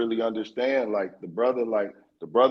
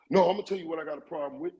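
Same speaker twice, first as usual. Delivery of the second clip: fast, higher mock angry holler; 9 semitones higher, much faster than usual. Retelling the podcaster turning up on him, mock holler. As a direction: imitate him going off, sped up.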